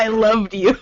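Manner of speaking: laughing through the words; giggling it out. Real, not acted. Repeating the text, laughing.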